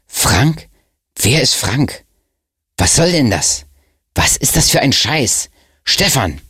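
high, agitated questions piling up; indignant outburst. Acted. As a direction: demand answers, losing patience. Schlim bursts out in confusion.

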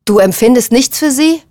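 heated, disbelieving question; confrontational question. A narrator's reading. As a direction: press them hard for an answer. Challenge in the quarrel.

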